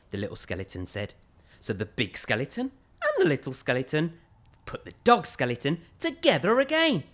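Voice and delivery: ordinary delivery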